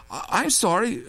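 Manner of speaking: high nervous stammer. A narrator's reading. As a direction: stumble over it, startled and scared. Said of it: "Touré stammered".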